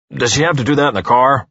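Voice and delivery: mildly barked complaint; a touch of gruff objection. A narrator's reading. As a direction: grumble it as a curt challenge. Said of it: "barks Uwe".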